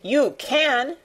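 put-on a girl's restrained shout. A narrator's reading. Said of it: "shouted Mary".

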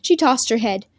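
ordinary delivery

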